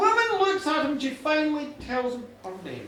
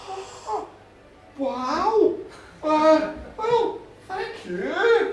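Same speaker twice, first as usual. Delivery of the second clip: loud, higher, halting thanks; flustered awe; 4 semitones higher, 7 dB louder, much slower than usual. Acted. Farmer realising a goddess shares his bed, flustered awe. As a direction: stumble from awe into drawn-out thanks.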